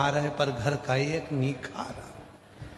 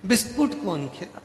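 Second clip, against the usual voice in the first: put-on much higher, loud childish whine; little grandson crying; 8 semitones higher, 5 dB louder than usual. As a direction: whimper like a small boy. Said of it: "छोटा सा पोता रो रहा था".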